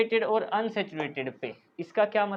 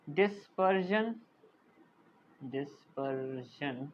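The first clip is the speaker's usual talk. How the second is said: drawn-out term, trailing softer on the repeat; slow dictation; 5 semitones lower, 9 dB quieter, much slower than usual. Real, not acted. Teacher writes a term for students, slow dictation. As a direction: stretch each syllable as you write it. Writing the term while saying it.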